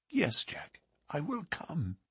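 high, hushed woman's voice; devoted sweetheart. Acted. Sweetheart agrees to go away with Jack, soft high trust.

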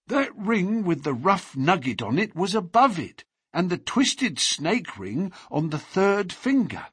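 a shaken servant, still polite; formal restrained butler. A narrator's reading. Butler explains the rings.

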